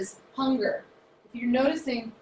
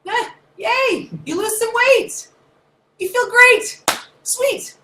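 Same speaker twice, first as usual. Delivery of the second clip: high, loud cheering; high loud happy cheer; celebrating; 13 semitones higher, 10 dB louder, slower than usual. Acted. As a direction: cheer like a win. Celebrating weight loss.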